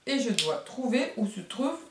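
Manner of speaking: normal talking voice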